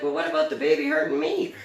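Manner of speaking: high-pitched mock protest; imitating protesting daughter. Daughter protesting to mom about the foal, imitated indignantly. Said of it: "She's like".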